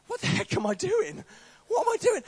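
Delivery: high panicked inner cry. Retold or imitated. Panicking inwardly as she leads him, high and loud. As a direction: squeak it in panic.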